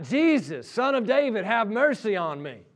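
retold yell from a blind beggar. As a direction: cry out over a crowd as a desperate beggar. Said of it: "he yells out".

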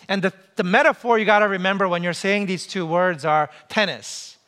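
usual speaking voice

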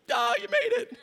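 retold teacher overjoyed at his return; delighted teacher. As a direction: squeal it like an old teacher overjoyed. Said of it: "she's like".

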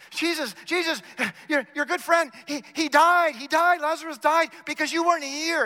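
high, loud, frantic plea; worked-up, high-pitched accusation; distraught reproach. Acted. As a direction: cry out as a grieving sister blaming a friend. Voices Martha's distressed reproach to Jesus.

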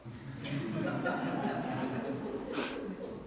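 soft breathy laugh at his own joke; light laugh. Real, not acted. Priest laughing at his own chapel joke. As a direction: laugh it off gently.

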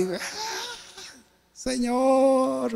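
high, drawn-out mock sob; imitated wailing. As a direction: act out a muffled wail to God. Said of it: "me ha tocado llorar a gritos".

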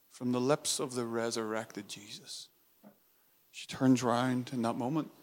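usual speaking voice

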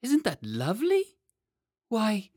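put-on high, loud childlike wonder; delighted boy king. A narrator's reading. Lionel admiring the painted butterfly, high delighted wonder. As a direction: marvel in a high little-boy voice.

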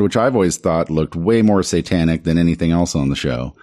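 ordinary delivery

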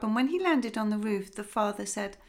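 plain reading voice

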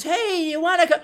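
high, lilting invitation; mock invitation. Imitating friends inviting him, high sweet voice. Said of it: "family and friends".

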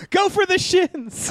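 high, fierce mock mom; mom quote. The mom urging her kids to attack Santa, high.

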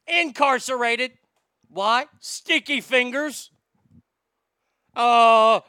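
loud, punchy sponsor pitch; hammed-up ad read. Host reading the J S Floors sponsor spot, loud and hammy. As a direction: sell the floors like a pushy pitchman.

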